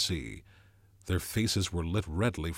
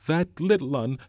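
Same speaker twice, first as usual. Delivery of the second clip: put-on higher, louder breathless boy; gasping; 8 semitones higher, 7 dB louder than usual. A narrator's reading. Piggy alarmed, out of breath and louder. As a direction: catch your breath mid-word. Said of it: "gasped Piggy".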